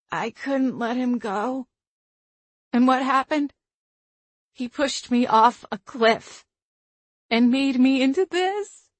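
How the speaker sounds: cracking, tearful voice. A narrator's reading. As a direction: let the voice crack. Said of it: "Her voice cracked".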